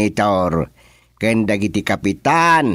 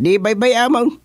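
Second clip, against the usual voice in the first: exasperated insistence; 13 semitones higher than usual. Acted. Son insisting to his parent, exasperated high pitch. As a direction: voice leaps high on the last words.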